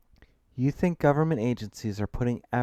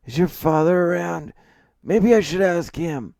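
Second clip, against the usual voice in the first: put-on condescending old man; 10 semitones higher, 9 dB louder than usual. A narrator's reading. McCrae condescending.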